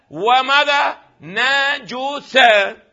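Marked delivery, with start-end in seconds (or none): 1.2-2.9 "نجسة" as na-ju-sa, stretched syllable by syllable; slow, higher "نجسة" with a u after the jim; damma on jim. Shown as a demonstration. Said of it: "أما الجيم تكون ماذا؟". («نجسة» بفتح النون وضم الجيم، ببطء)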